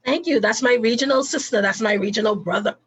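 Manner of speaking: loud, high, bursting with delight; excited praise. Real, not acted. Host cheering her guest, loud and thrilled.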